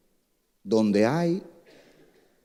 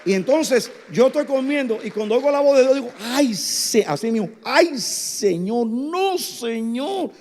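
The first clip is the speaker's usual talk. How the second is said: shocked preacher; 13 semitones higher than usual. Retold or imitated. Preacher relives hearing God's voice, high and shocked. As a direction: cry out in shocked disbelief.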